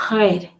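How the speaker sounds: "red" with a guttural French R. Shown as a demonstration. Teacher showing viewers how the French would say it, "red" with guttural R. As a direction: start "red" on a throaty French R. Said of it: "I'm probably not doing it justice".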